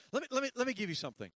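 fast, high eager voice; eager thanks. Retold or imitated. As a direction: rush it out like a flustered helper. Acting eager grateful stranger.

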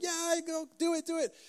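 high, cheering crowd voice; squeaky high cheer from the crowd. Retold or imitated. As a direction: egg him on like an excited crowd. Crowd urges tightrope walker on.

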